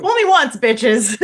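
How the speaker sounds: high, loud mock taunt; cheeky, high-pitched exclamation; playful triumphant jab. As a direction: crow it out loudly for a laugh. Jokey triumphant outburst, laughs after.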